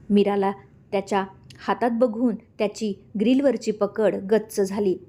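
ordinary delivery, nothing marked

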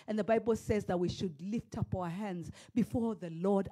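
ordinary talk, nothing marked

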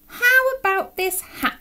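high, eager suggestion; hopeful little bear. Acted. Alan trying a hat as a potty, hopeful bear. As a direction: pipe up with a bright idea.

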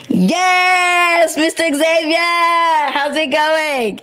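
loud, high hype-host welcome; hype greeting. Real, not acted. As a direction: pump up the guest's arrival. Host hypes up the guest.

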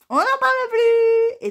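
very high, loud, drawn-out wail; exasperated outburst. Real, not acted. Vlogger venting about the jammed letterbox, exasperated wail. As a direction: let it explode in a long high cry.

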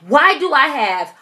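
loud high complaint to mom. Retold or imitated. Complaining to her mom about roaches, loud and high. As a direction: call out to your mother at full volume. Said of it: "and I'm loud".

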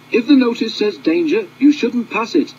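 high, loud chorus of engines warning; squeaky little engines scolding together. Acted. Engines warn sixteen off the danger notice, high and loud. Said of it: "they said".

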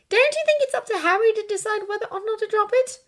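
put-on high, fast, challenging girl's voice; persistent Hermione. A narrator's reading. Hermione questioning Draco's grudge, insistent. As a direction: challenge him in a rapid high voice.

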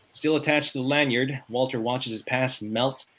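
ordinary talk, nothing marked

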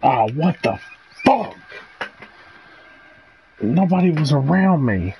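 real shock at a sudden death; shocked outburst. Real, not acted. Killed unexpectedly in game.

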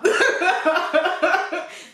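rire aigu. Real, not acted. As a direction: laisse échapper un rire haut. Rit encore. (rire aigu)

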